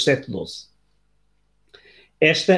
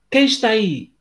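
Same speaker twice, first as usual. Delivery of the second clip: echo reply; 7 semitones higher than usual. Acted. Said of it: "recebeu como resposta".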